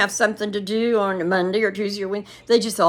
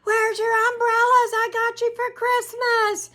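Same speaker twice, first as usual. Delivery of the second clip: high, carrying call to the family running to the car; retold scream; 14 semitones higher than usual. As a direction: shout it after them across the yard, half laughing. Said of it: "I was screaming".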